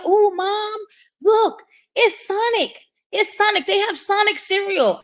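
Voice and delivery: put-on high, excited little boy; excited child voice. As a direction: squeal it like an excited kid. Son voiced in story.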